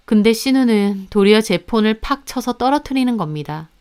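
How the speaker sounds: ordinary talk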